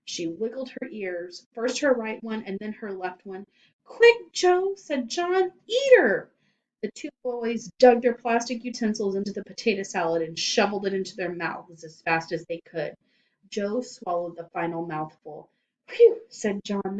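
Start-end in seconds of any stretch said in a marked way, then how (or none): 3.9-6.3 high loud panicked urging; urgent cry. Acted. John urging Joe to eat the witch, panicked yell. 15.9-16.2 high loud relieved whew. Acted. John relieved the witch is gone, whew.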